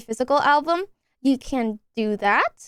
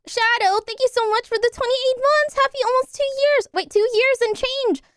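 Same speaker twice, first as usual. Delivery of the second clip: bright cheerful thanks; 10 semitones higher than usual. Real, not acted. Cheerful high thank-you to subscriber.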